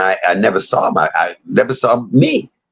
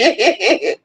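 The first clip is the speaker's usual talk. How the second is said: a sudden high squeal of a laugh; high burst of laughter. Real, not acted. Laughing at her own story of a stuttering toddler. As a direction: break into a high helpless laugh.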